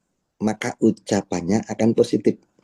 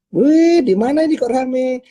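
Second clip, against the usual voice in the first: much higher and louder surprised wih; cheerful greeting; 6 dB louder than usual. Acted. Leader calling a member at the school, excited loud greeting. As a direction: burst out brightly on the phone.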